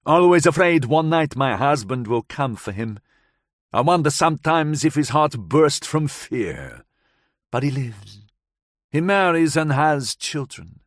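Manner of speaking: put-on light foreign accent, woman recounting; Gabriella's voice. Gabriella tells the narrator of that night, lightly accented.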